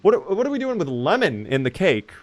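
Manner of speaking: high, swooping incredulous question; baffled disbelief. Real, not acted. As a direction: protest in high-pitched bafflement. Disbelief at the cake recipe.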